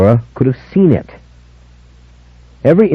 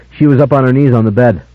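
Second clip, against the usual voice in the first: usual speaking voice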